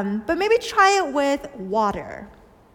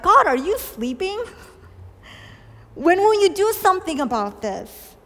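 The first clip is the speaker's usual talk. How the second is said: higher, louder pleading question to God; psalmist's complaint; 7 semitones higher, 4 dB louder than usual. Retold or imitated. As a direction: cry out impatiently to heaven. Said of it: "the psalmist asked questions like".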